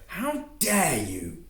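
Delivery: high, slow, indignant cry; outraged. Acted. Antoine enraged at Male's insult, outraged. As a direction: hurl it at him in fury. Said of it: "Aggression overtook an elation".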